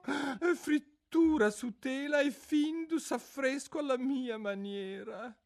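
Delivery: high, mournful creature voice; a sorrowful old turtle reminiscing; mock turtle voice. Mock turtle telling Alice about school under the sea, mournfully. Turtle lists other subjects.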